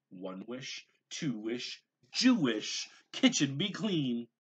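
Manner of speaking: rising to a loud high magic command; building spell. A narrator's reading. Gabriel showing his mother the spell, building loud incantation. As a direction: build the spell to a showy climax.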